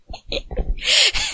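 squeaky high giggle; high laugh. Real, not acted. Reader laughing at her own flub, high giggle.

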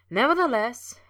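high, loud teasing echo of the word; mocking echo. A narrator's reading. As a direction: echo the word back playfully, mimicking. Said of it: "he echoed".

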